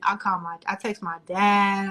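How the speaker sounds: ordinary delivery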